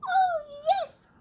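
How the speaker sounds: very high squeaky tiny voice; high piping mayor; piped. A narrator's reading. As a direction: pipe it up like a tiny Who. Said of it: "piped the voice".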